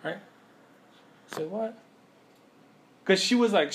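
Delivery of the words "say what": softer, higher, rising to a question; stunned disbelief. Acted. Daughter repeats her reply to the department head, stunned disbelief. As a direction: repeat it in hushed amazement. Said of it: "the waterworks stopped. She goes".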